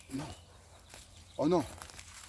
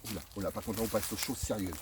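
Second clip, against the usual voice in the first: ordinary delivery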